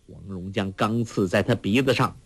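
ordinary delivery

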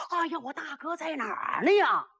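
high, wailing cry for help; hammed-up alarm with a stretched ending; mock panic. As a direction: call desperately for your big brother. Chased fighter cries for brother.

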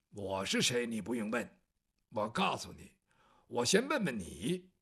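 low, soft, menacing voice; cold low menace. Acted. Bai Yutang confronting Guo the eunuch, low and cold. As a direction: say it low and dangerous.

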